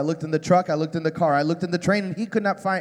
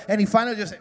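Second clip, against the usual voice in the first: ordinary talk, nothing marked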